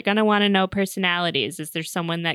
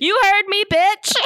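mock bratty child, higher and louder; cheeky kid; 12 semitones higher, 4 dB louder than usual. Kid snapping at mom's fiance, mock bratty and high. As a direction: sass him like a defiant six-year-old.

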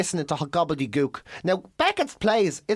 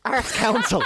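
usual speaking voice

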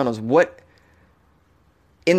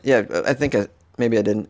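usual speaking voice

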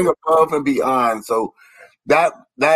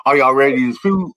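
imitating his stiff delivery; 5 semitones lower than usual. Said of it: "that's how he talk".

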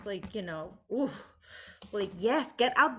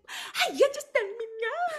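mock pleading; 11 semitones higher than usual. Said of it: "It's like".

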